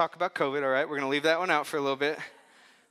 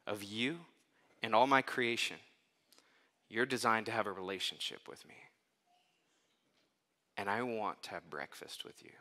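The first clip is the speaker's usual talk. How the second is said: tender Jesus; 4 semitones lower, 4 dB quieter than usual. Retold or imitated. Jesus tenderly speaks to Peter.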